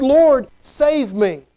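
drawn-out, deliberate prayer; slow plea. Acted. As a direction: stretch each word like a plea. Sinner pleading to the Lord.

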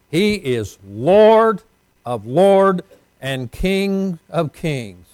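slow, loud, drawn-out proclamation; slow proclaiming. Real, not acted. Preacher to congregation, slow loud proclamation. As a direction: ring out each word of praise.

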